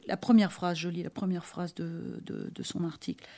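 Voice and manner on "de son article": ordinary talk, nothing marked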